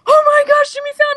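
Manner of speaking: high-pitched, excited fan squeal of recognition; amazed fans. Retold or imitated. Onlookers spotting Jimmy Fallon, excited mock exclamation. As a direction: gush like a starstruck onlooker. Acting out amazed fans' reaction.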